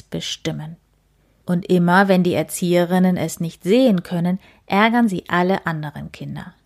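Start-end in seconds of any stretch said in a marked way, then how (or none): none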